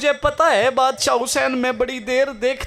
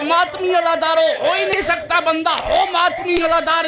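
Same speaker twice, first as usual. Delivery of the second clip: preaching at full force; 4 semitones higher than usual. Acted. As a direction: rouse the mourners at full pitch. Climax of majlis address.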